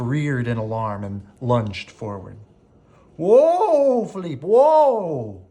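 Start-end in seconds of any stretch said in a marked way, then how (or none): 3.2-5.4 loud, high, drawn-out whoa; cried. A narrator's reading. Maurice cries out to his panicking horse, loud, high and drawn out. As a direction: haul back a bolting horse with your voice. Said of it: "cried Maurice".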